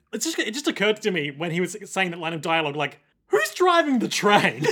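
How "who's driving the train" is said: louder, higher drawn-out outcry through laughter; laughing, hammed-up panic; mock alarm. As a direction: cry it out as a comic realisation. Joking alarm at dead conductors.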